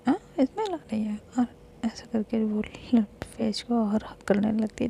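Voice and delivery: a quiet late-night telling of a couple's banter; hushed storytelling. Acted. Soft intimate late-night retelling.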